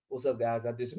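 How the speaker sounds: low, soft, unassuming greeting in imitation; Boxer Hacker. Imitating a panel guest greeting everyone before being cut off, low mild impression. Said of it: "All Boxer Hacker gotta do is say".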